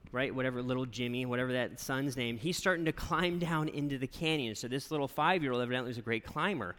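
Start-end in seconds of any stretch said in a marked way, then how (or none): none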